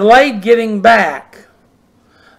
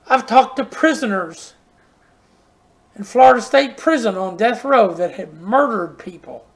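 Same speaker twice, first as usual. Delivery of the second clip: ordinary delivery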